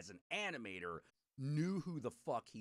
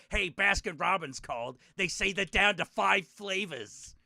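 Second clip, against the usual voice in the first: insult comic; 5 semitones higher, 12 dB louder than usual. Retold or imitated. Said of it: "look at a fat dude and say".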